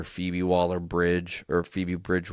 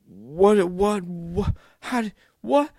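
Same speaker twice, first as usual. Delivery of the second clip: much higher, louder, squeaky repeated whats; stunned disbelief; 11 semitones higher, 5 dB louder, slower than usual. Retold or imitated. Fan recalling Darth Maul's return, high stunned disbelief. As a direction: replay the shock of seeing Maul return. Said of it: "So I was just like".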